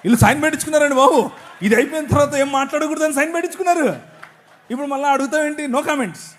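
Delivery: high-pitched, fast-starting loud complaint; loud protest. Real, not acted. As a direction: protest loudly at being asked. Protesting over a signed silence agreement.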